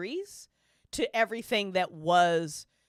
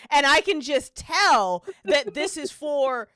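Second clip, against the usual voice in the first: excited; 5 semitones higher, 7 dB louder, much slower than usual. Retold or imitated. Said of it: "she goes".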